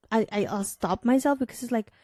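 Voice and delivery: ordinary talk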